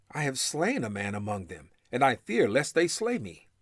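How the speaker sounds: usual speaking voice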